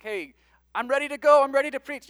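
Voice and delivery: high, loud and eager, ready to go; eager volunteer. Retold or imitated. Preacher voicing eager Isaiah, high loud excitement. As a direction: burst in like a keen recruit.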